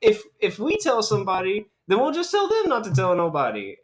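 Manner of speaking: put-on high, light child voice; kids voice. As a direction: lift the pitch like a pleading kid. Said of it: "the little kids are like".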